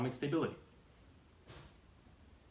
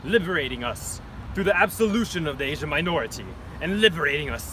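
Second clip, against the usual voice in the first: dictator voice; 2 semitones higher, 8 dB louder than usual. Acted. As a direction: brood like a masked tyrant. Dictator reflecting.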